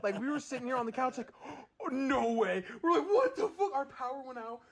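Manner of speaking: high, fast, shaken retelling; re-living the scare, pitch high; real fright. Real, not acted. Recounting the blackout to a friend, frightened. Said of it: "about fucking shit a brick".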